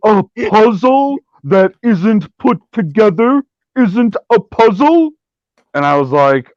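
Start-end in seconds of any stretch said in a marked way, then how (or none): 0.0-5.1 mocking fan voice. Fan's argument quoted mockingly.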